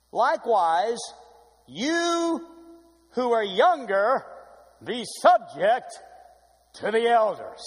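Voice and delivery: slow, loud, sonorous reading of the verse; preacher intoning the verse to the congregation, slow and loud; dramatic scripture reading. Acted. Slow, swelling preacher voice. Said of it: "Like the way I said that?".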